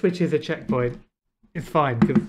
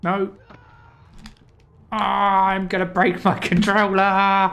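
raging gamer; 4 semitones higher, 6 dB louder than usual. Real, not acted. Raging gamer after dying.

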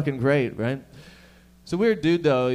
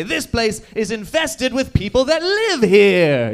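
slow, loud, higher indignant complaint; mock outrage; 7 semitones higher, 8 dB louder, slower than usual. Conquerors complaining about locals, slow mock outrage. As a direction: whine it like an outraged colonizer. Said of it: "and then say".

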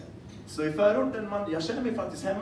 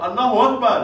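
loud shout; 6 semitones higher, 12 dB louder than usual. Acted. Comedian shouting across a crowded street to his friend, loud and laughing. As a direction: shout it much louder and higher, laughing.

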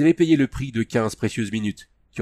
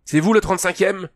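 higher, louder calling out; shouted question; 10 semitones higher, 6 dB louder than usual. A narrator's reading. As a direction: call out to find the regiment. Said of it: "hurla une voix dans les ténèbres". (question criée fort)

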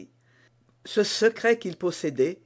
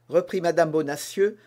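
plain reading voice

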